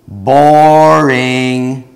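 one word, thrown out loud and high; a loud, blunt boring; mock loud verdict. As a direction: slam the word at him. Blunt verdict to grieving man.

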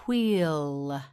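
"wheel" with a long stretched vowel; drawn out. Shown as a demonstration. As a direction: hold the ee in "wheel".

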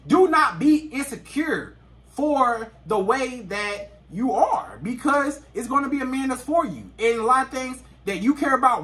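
usual speaking voice

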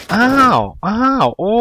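high, slow amazed oh; drawn-out surprise. Real, not acted. Streamer reacting to a game find, high and slow in amazement. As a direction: stretch out the amazement like you can't believe it.

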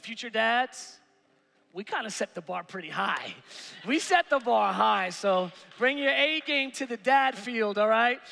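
ordinary talk, nothing marked

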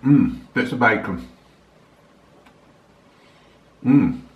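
savoring while chewing. Real, not acted. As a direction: mouth partly full. Seen 0: Lips closed, slight jaw movement after eating; spoon down, no new bite.

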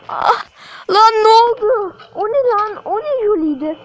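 sudden fright, loud; startled. Real, not acted. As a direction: jump at the scare. Sudden scare in game.

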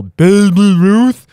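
hammed-up shout; mock yell. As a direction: bellow it like Chunk. Goonies Chunk reference, yelled.

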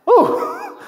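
high, drawn-out "ooh" of wonder; impressed ooh. Real, not acted. Preacher marvels at the man's trust, impressed high ooh. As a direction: sound amazed at his faith.

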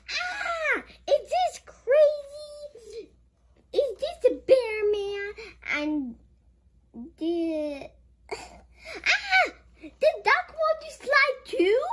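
high, amazed questions; excited. Real, not acted. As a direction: marvel at the picture.

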